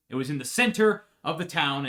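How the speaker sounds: usual speaking voice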